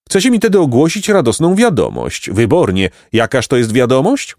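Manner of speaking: inquisitive pompous donkey. A narrator's reading. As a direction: ask with self-important curiosity.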